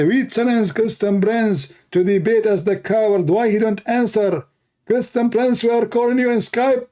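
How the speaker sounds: high, whiny mockery of the challengers. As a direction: parody their taunt, pitched well up.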